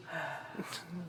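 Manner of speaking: long, soft, tired exhale; a quiet resigned sigh at Duke Telnor; weary sigh. Acted. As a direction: sigh at the same old mess. Sees a guest spill a drink.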